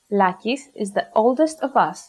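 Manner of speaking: normal talking voice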